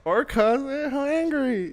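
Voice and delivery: high, slow teasing line. Real, not acted. Co-host teasing high and slow about hangry orcas. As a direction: stretch it up playfully. Joking aside.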